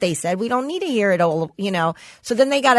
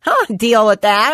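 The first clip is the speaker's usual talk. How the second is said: ordinary talk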